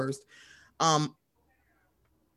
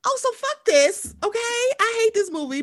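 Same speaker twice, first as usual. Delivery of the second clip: high mocking voice; 3 dB louder than usual. Hating a Superman movie, high put-on voice. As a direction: squeal it out in a high mock voice. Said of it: "I was like".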